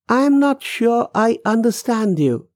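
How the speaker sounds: high-pitched, uncertain reply; confused man. Acted. Man baffled by the young woman's words, confused. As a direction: pitch it up, unsure.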